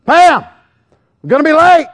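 high, loud call to the wife; re-enacted harried husband's shout; acted impatient yell. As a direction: holler through the house that time is up. Acts out yelling to wife, late.

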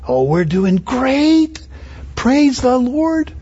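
slow, sing-song mock praise, rising high on the Lord; mock cheerful reply. Preacher imitating a believer's reply, slow and sugary. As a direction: drag it out like a pious brush-off. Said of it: "You ask a brother or sister".

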